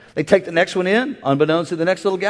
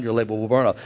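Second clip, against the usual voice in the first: ordinary delivery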